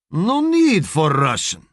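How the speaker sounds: put-on slow, Russian-accented English; a Russian trainer speaking broken English. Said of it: "says the big man".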